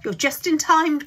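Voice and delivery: put-on loud, high old man's shout. A narrator's reading. Grandpa Joe telling Charlie he's just in time, loud shout. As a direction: shout it out with joy. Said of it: "shouted Grandpa Joe".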